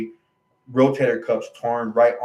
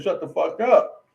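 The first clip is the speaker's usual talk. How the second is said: higher, slower imitation of a snapping doctor; mock snap, raised pitch, measured pace; retold angry snap; 6 semitones higher, 3 dB louder, slower than usual. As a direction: act out his curt outburst, drawn out, pitch raised. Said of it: "he just snaps on me".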